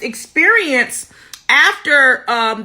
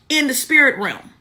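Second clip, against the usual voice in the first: usual speaking voice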